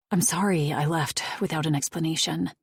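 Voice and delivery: plain reading voice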